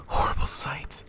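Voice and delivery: breathless hushed horror; horrified gasp of words; appalled whisper. Acted. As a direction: whisper in shock. Macbeth appalled at vision.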